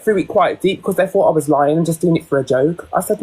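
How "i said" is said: usual speaking voice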